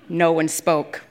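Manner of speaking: ordinary delivery, nothing marked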